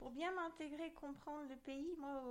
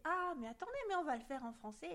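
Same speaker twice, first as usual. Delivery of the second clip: imitating locals, higher and louder opening; eager interjection; 5 semitones higher, 5 dB louder, faster than usual. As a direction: jump in helpfully, as a retold quote. Retold locals eagerly switching to French.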